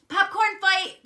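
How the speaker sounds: high, loud battle cry; gleeful cry. A narrator's reading. Froggy starting a popcorn fight, high loud cry. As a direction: shout it high to start the fun. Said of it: "cried Froggy".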